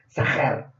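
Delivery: "zahar" said softly, with a strong j-like sound and the stress on the final syllable; "zahar" as in Hebrew, stress at the end; Hebrew word demo. Shown as a demonstration. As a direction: pronounce "zahar" slowly. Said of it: "con Z y con acento al final y J".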